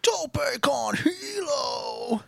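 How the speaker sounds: very high, slow move call; drawn-out announcer imitation; mock commentator call. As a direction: stretch it out in a high put-on voice.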